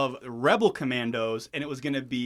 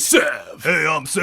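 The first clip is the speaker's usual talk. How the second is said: louder gruff mock soldier; lightly voiced edgy commando; 5 dB louder, slower than usual. Imitating Sev character.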